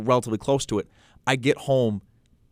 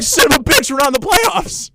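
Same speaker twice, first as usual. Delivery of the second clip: high, loud cursing wail; re-enacted fan meltdown; acted furious yell; 5 dB louder than usual. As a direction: explode as the awful truth sinks in. Acts out delayed realization while driving.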